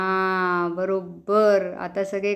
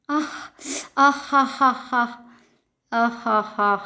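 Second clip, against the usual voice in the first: panting laugh; slower than usual. Pretend. Chili burns, calls for water.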